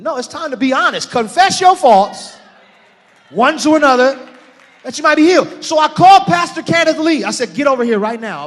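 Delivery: high, loud exhortation; full-force preaching. Real, not acted. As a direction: proclaim it to the whole church.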